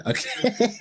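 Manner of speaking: high, helpless laughing words. Real, not acted. Streamer cracking up at two pregnant women, laughing. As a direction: say it through real laughter.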